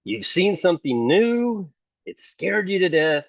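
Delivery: ordinary talk, nothing marked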